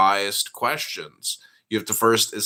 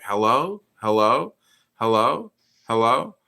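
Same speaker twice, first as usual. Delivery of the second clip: dismissive hello; 2 semitones higher, 6 dB louder than usual. Shown as a demonstration. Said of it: "that's me being disingenuous, uh, by saying hello".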